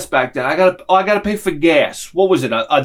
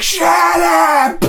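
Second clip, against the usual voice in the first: furious high shout; angry shout; 14 semitones higher, 5 dB louder than usual. Retold or imitated. Quint yelling at the young guy, furious shout. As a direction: snap at him to shut up. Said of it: "he's like".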